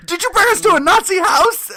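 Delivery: high, loud strained mock shriek; whisper shrieking. Bandmate tells singer about the Nazis, mock whisper-shriek. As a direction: shriek it like a frantic secret. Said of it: "I'm whisper shrieking".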